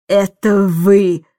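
slow, weighty accusation; stern accusation. A narrator's reading. Miss Andrew pointing at Mary Poppins, accusing. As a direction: press each word accusingly.

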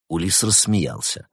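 usual speaking voice

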